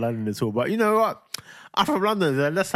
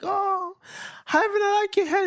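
put-on very high fawning kid voice; high-pitched admiring mock voice; Luca; slower than usual. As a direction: imitate a classmate swooning over his hair. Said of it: "Luca's gonna be like".

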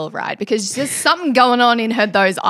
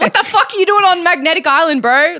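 louder, higher exasperated yell; exasperated shout; 8 semitones higher, 6 dB louder than usual. Real, not acted. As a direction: demand an answer, baffled. Exasperated question to Stav.

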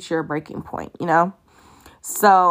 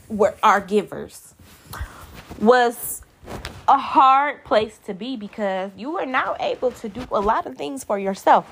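normal talking voice

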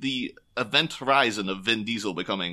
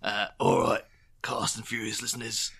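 Batman; 2 semitones lower, 4 dB quieter than usual. Pretend. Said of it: "Is he Batman?".

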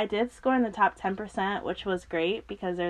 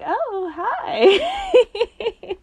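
high, louder hello breaking into laughter; awkward greeting; 13 semitones higher, 7 dB louder than usual. Retold or imitated. Acts out her startled, awkward greeting.

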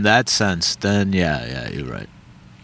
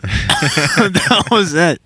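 laughing disbelief; 11 semitones higher, much slower than usual. Real, not acted. Laughing at doctor's advice.

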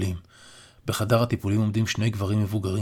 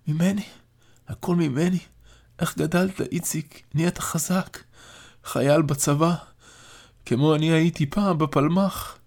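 put-on higher, weak, wondering old man; frail old father; 7 semitones higher than usual. A narrator's reading. Dying grandfather admires his soldier grandson, weak and higher. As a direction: marvel at your grown grandson.

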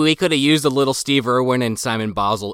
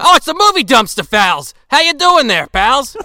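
loud, high Australian greeting; Steve Irwin; 9 semitones higher, 5 dB louder than usual. Acted. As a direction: hail your pals in a shaky-grip Australian accent. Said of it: "I'm losing the accent already".

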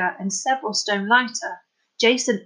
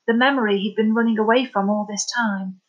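plain reading voice

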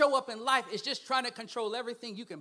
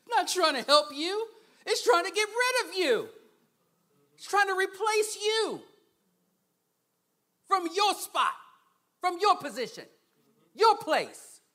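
loud, high preaching; full-force preaching; 11 semitones higher, 10 dB louder than usual. Real, not acted. Preacher warning the congregation about that spirit, loud fiery preaching. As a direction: drive it home to the congregation.

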